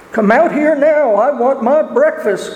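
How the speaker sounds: big bad wolf, gruff and demanding; growling wolf. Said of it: "growled the wolf".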